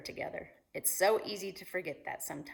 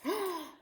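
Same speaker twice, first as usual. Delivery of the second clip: sharp gasp; 12 semitones higher than usual. Acted. Climax of story.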